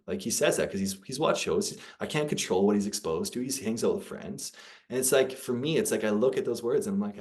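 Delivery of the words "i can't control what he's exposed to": usual speaking voice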